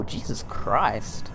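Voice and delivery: ordinary delivery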